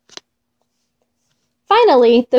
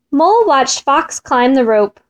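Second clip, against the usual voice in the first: plain reading voice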